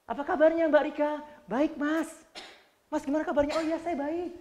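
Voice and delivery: lively, high friendly exchange; upbeat greeting demo. Shown as a demonstration. Trainer showing a friendly apa kabar exchange, high and bright. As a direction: make the greeting sound genuinely good. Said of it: "bener-bener menunjukkan baik".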